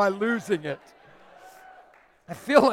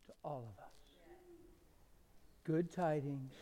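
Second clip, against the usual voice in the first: soft, reverent sermon voice, near a whisper; hushed, reverent preaching that fades into a whisper; 4 semitones lower, 20 dB quieter than usual. Acted. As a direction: draw them in, almost whispering.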